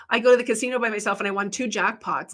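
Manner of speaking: ordinary talk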